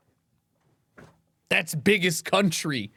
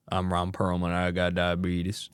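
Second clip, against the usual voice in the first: low mock Ron Perlman voice, much lower and softer; Ron Perlman impression; 8 semitones lower, 5 dB quieter than usual. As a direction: drop the voice low and play the actor as a joke. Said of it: "Ron Perlman, that's Mister Diabetes".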